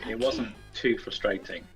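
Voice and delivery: ordinary talk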